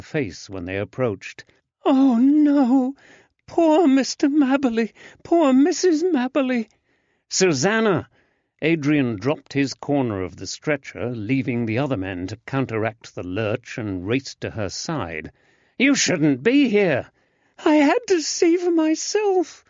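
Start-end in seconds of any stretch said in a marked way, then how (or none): 1.8-6.7 put-on much higher, louder young woman in dismay; distressed young lady. Susanna's distressed high voice. 7.3-8.1 higher, louder call of her name. Acted. Adrian calls out to her. 15.8-17.1 higher, louder, slow urgent protest; alarmed protest. Acted. Adrian alarmed at Susanna. 17.6-19.6 put-on much higher, louder young woman's wail. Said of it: "she wailed".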